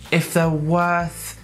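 ordinary talk

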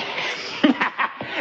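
soft, breathed laugh. Acted. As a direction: chuckle quietly at your own plan. Laughs at own party plan.

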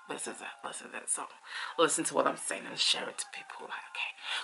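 soft unvoiced whisper, a bit fast. Real, not acted. Whispering to her followers about sharing. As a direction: lean in and whisper it. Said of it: "I don't know why I'm whispering".

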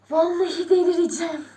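low, soft, overwhelmed voice; stunned hush. Real, not acted. Streamer overwhelmed by ten thousand viewers, stunned hush. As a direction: sink into a stunned undertone.

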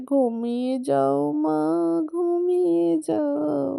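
slow, drawn-out soothing to sleep; lulling. Acted. Grandparent lulling baby Mila to sleep, slow and soothing. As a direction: stretch each word like a lullaby.

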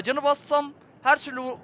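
ordinary talk, nothing marked

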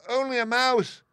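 very high, loud, offended little mouse; indignant squeak. A narrator's reading. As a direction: squeal it back in outrage. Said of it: "cried the little animal indignantly".

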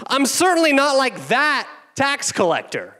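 high, loud, self-satisfied praying; smug Pharisee prayer. Acted. Pastor mocking the Pharisee's prayer in a high smug voice. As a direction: preen before God like a proud Pharisee. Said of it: "And then he points someone out".

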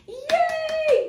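high, loud cheer; excited cheer. Acted. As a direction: whoop for the kids. Cheering for kids.

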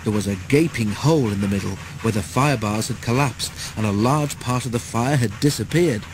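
plain reading voice

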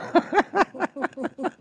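high giggly laugh. Real, not acted. Speaker sharing delight in God's love, breaking into laughter. Amused by her own testimony.

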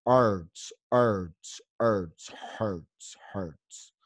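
"hurts" repeated with the English er, pitch stepping down; pronunciation drill. Shown as a demonstration. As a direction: drill "hurts" one by one, sinking and fading. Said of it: "El tema de practicar el sonido".

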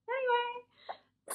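high, slow, drawn-out sing-song; goofy singsong sign-off; sing-song anyway. Pretend. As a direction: stretch it out way up high, playfully. Repeats word in silly voice.

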